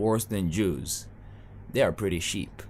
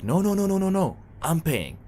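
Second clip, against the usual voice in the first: Jali friend insisting; 9 semitones higher than usual. Retold or imitated. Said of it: "He'll be like".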